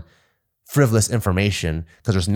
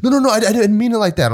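high panicked pleading; 10 semitones higher, 3 dB louder than usual. Retold or imitated. Recruit pleading after being hit.

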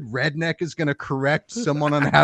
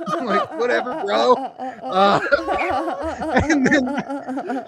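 giggling through the words; cracking up mid-sentence; laughing; 7 semitones higher, slower than usual. Real, not acted.

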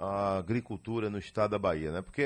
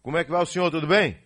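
raised voice over a bad phone line; to someone hard of hearing; 6 semitones higher, 6 dB louder, much faster than usual. Real, not acted. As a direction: call out clearly so the caller hears. Bad phone line, greeting guest. (falando alto para quem ouve mal)

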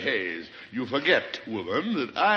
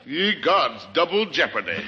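normal talking voice

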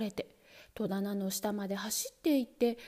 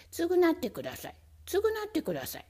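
high, insistent begging voice; whiny pleading demand; 6 semitones higher, faster than usual. Acted. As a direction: nag for payback. Mouse demands compensation.

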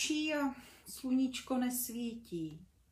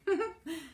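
short high laugh; light laugh; 7 semitones higher than usual. Acted. Mum laughs as the kids tumble out of bed, a short high laugh.

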